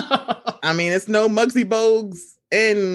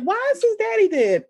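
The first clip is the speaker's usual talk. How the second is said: put-on kid's question; 12 semitones higher than usual. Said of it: "I'm like".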